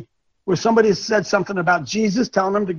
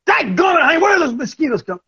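mock frustrated outburst; 7 semitones higher than usual. Swatting a mosquito, frustrated.

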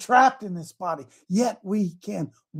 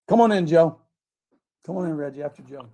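usual speaking voice

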